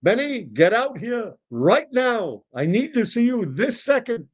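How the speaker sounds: high, swooping, insistent summons; demanding old customer. Acted. Old customer summoning busy deli owner, demanding. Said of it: "old Mister Rabinovich says".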